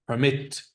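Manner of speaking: Permit stressed on the second syllable; verb stress. Shown as a demonstration. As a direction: say Permit as a verb, per-MIT. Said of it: "how's this verb pronounced?".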